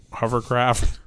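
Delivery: ordinary talk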